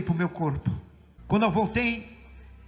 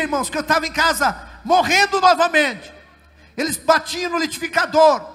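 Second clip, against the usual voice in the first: raised, high-pitched preacher's storytelling; impassioned testimony preaching; 6 semitones higher, 5 dB louder than usual. Real, not acted. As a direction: drive the testimony forward with a lifted voice.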